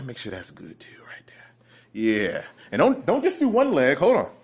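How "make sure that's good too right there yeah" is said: very soft, low whisper; barely audible, intimate aside; hushed whisper. Pretend. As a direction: drop right down into a whisper. Softly narrating while putting lotion on.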